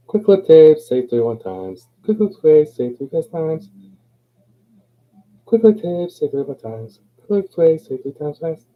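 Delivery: high and punchy, rattled off; loud attack on each repeat, words tumbling; rapid tongue twister. Shown as a demonstration.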